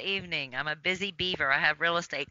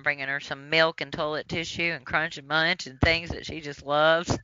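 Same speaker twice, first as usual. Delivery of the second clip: normal talking voice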